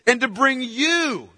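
slow build to a stressed, drawn-out "you"; emphatic "you". Acted. Angel bringing news to Zechariah himself, emphatic "you". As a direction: stretch and lean on "you". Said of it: "that's emphatic".

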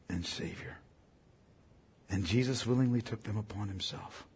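soft, low, hushed storytelling; hushed solemn narration. Acted. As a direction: draw them in with a hush.

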